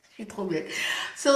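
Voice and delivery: ordinary talk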